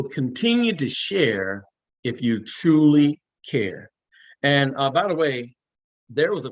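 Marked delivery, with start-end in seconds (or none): 0.0-3.9 slow sign-off slogan. Real, not acted.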